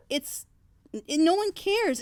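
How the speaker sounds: ordinary delivery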